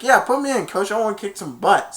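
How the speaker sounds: high, pushy plea to the coach; eager plea. Retold or imitated. Junior asking the coach to put him in, high and eager. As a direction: beg to go in. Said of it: "he's like".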